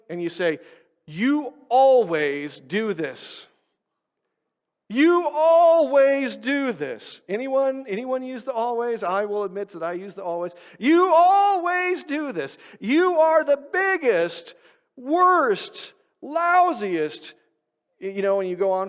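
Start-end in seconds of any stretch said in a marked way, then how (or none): 1.1-3.5 slow, drawn-out accusing tone; mock accusation. Demonstrating an angry accusation. 4.9-7.2 high, loud, slow accusation; exasperated accusing yell. Retold or imitated. Repeating accusation louder. 10.8-17.3 high, loud, slow accusing tirade; mock angry rant.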